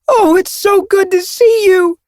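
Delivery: high, loud and slow with joy; overjoyed. A narrator's reading. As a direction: gush with delight at the reunion. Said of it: "she called".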